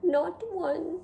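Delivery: a hint of a vain French snail, chatty; lightly voiced French snail. Snail Escargot chatting with the reader, lightly French. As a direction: play the charming snail talking to the reader.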